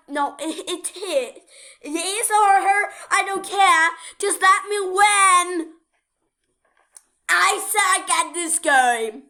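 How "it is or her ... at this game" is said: loud, higher-pitched gamer outburst; frustrated shouting. Real, not acted. Player desperate to win the game, loud frustrated complaint. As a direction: blurt it loudly in exasperation.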